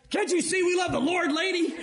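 high-pitched comic protest; exasperated high voice, played for laughs; mock exasperation. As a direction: plead in hammed-up annoyance at an interruption. Acts out exasperation at pestering attendant.

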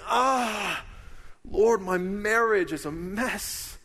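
high, slow cry to the Lord; a troubled listener crying out to God; anguished plea. Retold or imitated. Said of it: "you're saying".

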